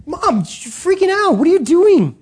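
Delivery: put-on high, exasperated teen protest; a teenage son pleading with his panicking mom. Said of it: "he's probably like".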